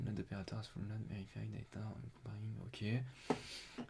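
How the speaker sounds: low, very soft skimming murmur; reading to oneself. Real, not acted. Streamer reading technical terms off the page, murmured low. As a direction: mumble through the terms as you read. Lit l'écran pour lui-même.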